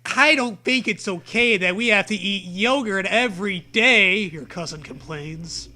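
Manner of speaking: ordinary delivery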